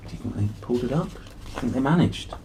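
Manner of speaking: ordinary delivery, nothing marked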